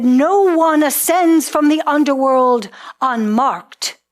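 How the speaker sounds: judges' booming verdict in a storyteller's voice; stern decree. A narrator's reading. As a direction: pronounce it as an unbending law. Judges seize her, pronounce law.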